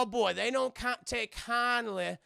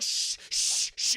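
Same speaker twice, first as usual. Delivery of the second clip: sharp unvoiced hushes, repeated; urgent shushing; slower than usual. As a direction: shush someone who is screaming. Acts out hushing the screaming woman.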